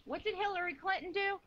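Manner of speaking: usual speaking voice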